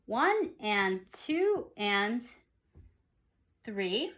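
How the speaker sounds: very slow counting with each "and" spoken out; slow, spelled-out subdivision count; slow subdivided count. Shown as a demonstration. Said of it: "So I'm counting it".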